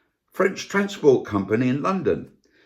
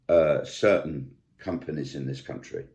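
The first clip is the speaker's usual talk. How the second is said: normal talking voice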